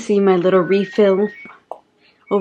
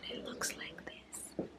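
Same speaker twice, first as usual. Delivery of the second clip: whispering; 4 semitones lower, 29 dB quieter than usual. Real, not acted. Quiet reveal of the food.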